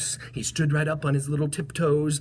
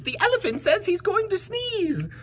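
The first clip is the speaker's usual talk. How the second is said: high bird voice. A narrator's reading. Voices the birds.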